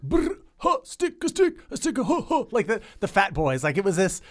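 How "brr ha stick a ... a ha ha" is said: high, far-off beatbox sounds; playful early beatboxing; old-school beatbox. Shown as a demonstration. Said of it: "Beatboxing back then was like".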